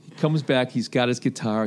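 ordinary talk, nothing marked

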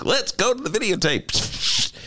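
slow, high mock anchor call; a lighter sportscaster echo; lightly voiced sportscaster. Savoring the sports guy's line, slow mock announcer call. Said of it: "I love these".

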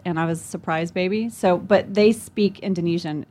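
normal talking voice

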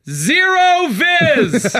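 very high, drawn-out imitation; diver's deadpan-report impression. Old diver reporting up to the dock, drawn-out imitation. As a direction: stretch both words out in a put-on voice. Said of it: "looks up and just goes".

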